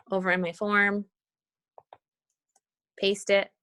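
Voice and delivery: ordinary delivery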